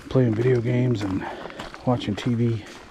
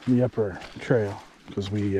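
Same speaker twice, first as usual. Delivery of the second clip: ordinary delivery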